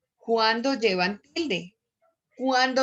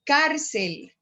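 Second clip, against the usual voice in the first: CÁRCEL with CÁR pitched high; "Cárcel" with a high, strong CÁR; stressed syllable; 5 semitones higher than usual. Shown as a demonstration. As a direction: punch the first syllable of "Cárcel", then drop. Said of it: "cárcel tiene sobre la A la sílaba tónica".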